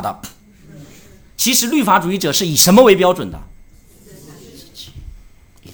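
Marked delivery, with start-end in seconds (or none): 4.0-5.7 whispered, much softer punchline; dramatic whisper. Real, not acted. Preacher answering his own question to the church, whispered. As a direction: hush it like a secret revealed.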